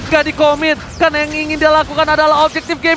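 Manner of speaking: high, loud rapid-fire call; excited caster. Acted. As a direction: ride the hype of the catch. Caster hypes a catch.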